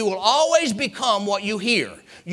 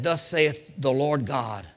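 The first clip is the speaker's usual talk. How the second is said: prophetic declamation; 4 semitones lower than usual. Acted. Ezekiel speaking God's word to bones, solemn building proclamation. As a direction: declaim it solemnly, gathering force toward the end.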